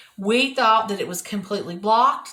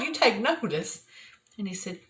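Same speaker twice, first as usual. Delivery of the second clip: normal talking voice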